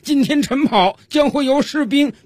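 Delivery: high-pitched drill instructor addressing the troops; loud announcement. Acted. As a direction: proclaim it to the recruits in a high, put-on voice. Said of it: "训练军是大声宣布道". (教官高声宣布)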